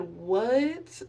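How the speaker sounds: long drawn-out rising what; incredulous, swooping question; stunned what. Retold or imitated. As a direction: stretch it upward in disbelief. Reacting to job offer.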